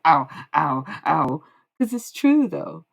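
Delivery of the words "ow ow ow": loud teasing "ow, ow"; playful catcall of ow, ow; louder mock wolf-whistle ows. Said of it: "I was going".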